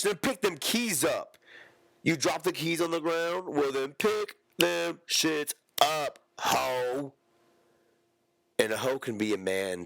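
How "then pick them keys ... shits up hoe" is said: higher, mock-outraged scolding; high mock scolding, slow on pick them shits up. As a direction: play a sassy character berating someone. Acted scolding voice in sketch.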